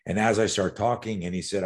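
usual speaking voice

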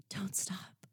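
soft whispered plea; hushed plea. A narrator's reading. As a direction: breathe it out with need. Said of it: "My voice was heavy with need".

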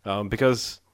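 normal talking voice

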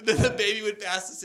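ordinary delivery

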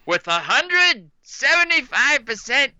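drawn-out dramatic paternity verdict; Maury Povich impression. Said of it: "Maury Povich come out and be like".

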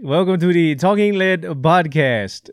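put-on slow, high Russian-accented "welcome to the"; mock Russian accent. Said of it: "your Boris voice".

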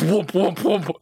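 high-pitched "whoop" noises; imitated whooshes. Player imitating balls flying into the ceiling, high whoops. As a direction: fling out each whoop high like a tossed ball.

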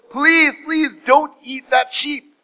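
high, slow, drawn-out plea; pleading. Acted. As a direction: beg earnestly, stretching the words. Noah begs the lion.

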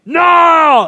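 a full shout of no. Shown as a demonstration. Padre enseñando a sus hijos a gritar no, con un grito fuerte. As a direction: yell no like you mean it. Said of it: "grítame no".

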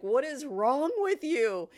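high, loud exasperated demand; pissed-off husband. Retold or imitated. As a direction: imitate an outraged husband scolding. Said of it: "my husband was pissed".

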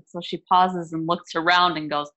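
ordinary talk

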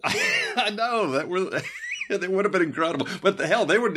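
high-pitched, talking through laughter; cracking up as you speak; words bubbling out through real laughter; laughing. Real, not acted. Cracking up at the idea.

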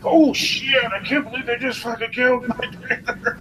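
high, squeaky mock shock; hammed-up high-pitched disbelief; shocked outburst. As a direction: retell your stunned reaction way up high. Retelling shocked reaction in theater.

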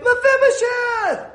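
high, drawn-out shouted name; calling out. Acted. King David calling Jonathan's son, high shout. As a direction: holler the name high and slow.

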